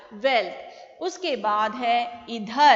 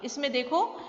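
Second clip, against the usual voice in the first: ordinary talk, nothing marked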